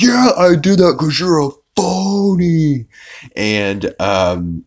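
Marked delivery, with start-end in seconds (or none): 0.0-2.9 high, louder sneering cartoon voice; smug phony guy. Phony guy bragging about the car, high and smug. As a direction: gloat it in a high put-on voice. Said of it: "And he's like".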